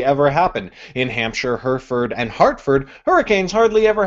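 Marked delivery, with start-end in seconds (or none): none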